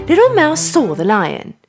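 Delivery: ordinary delivery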